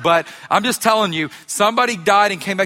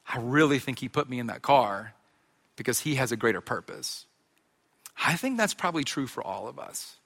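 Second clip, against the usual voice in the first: quieter, thoughtful sermon voice dropping softer; soft reflective preaching; 5 semitones lower, 5 dB quieter than usual. Acted. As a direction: lean in and preach it gently.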